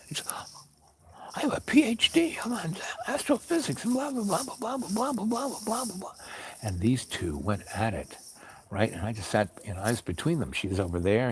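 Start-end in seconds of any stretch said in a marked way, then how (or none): 1.3-6.1 high-pitched boasting tirade; hammed-up squabbling, voice way up; mock indignant rant. Acted quarrel, boasting of credentials.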